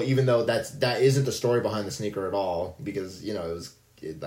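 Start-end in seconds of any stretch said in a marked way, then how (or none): none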